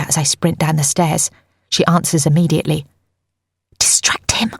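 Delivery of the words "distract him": hissed, whispered order; urgent hiss. A narrator's reading. Narrator to friend Nika on the phone while sneaking out, hissing. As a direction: whisper it sharply through your teeth. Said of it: "I hiss".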